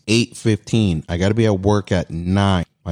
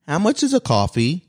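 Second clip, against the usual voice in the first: high mock old-lady question; old lady asking; 8 semitones higher than usual. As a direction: ask like a fussy old lady. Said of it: "This lady goes".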